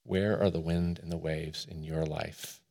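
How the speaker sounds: very soft, low-pitched question; hushed, pensive pulpit question; hushed reflective question. Real, not acted. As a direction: ask it low and quietly, leaving space. Question repeated more quietly for reflection.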